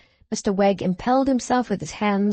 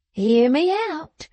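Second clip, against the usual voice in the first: slow insistent Wegg; 7 semitones higher, slower than usual. A narrator's reading.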